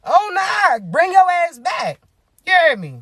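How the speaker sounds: high, slow, drawn-out call; calling someone back. Acted. Host ordering a fleeing target back, acted high call.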